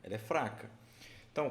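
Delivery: ordinary talk, nothing marked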